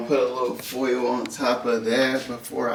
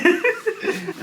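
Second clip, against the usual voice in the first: laughing. Real, not acted. Laugh after call.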